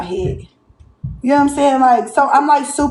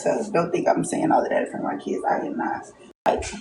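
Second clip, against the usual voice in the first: whisper; 9 dB quieter than usual. Real, not acted. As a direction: breathe it out, voiceless and secret. Kids downstairs, hiding the words.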